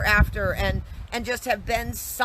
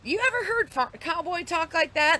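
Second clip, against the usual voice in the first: higher-pitched farmer voice, baffled; puzzled old farmer; 7 semitones higher than usual. Retold or imitated. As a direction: imitate a farmer puzzling aloud to his buddy. Voices a puzzled farmer asking the other.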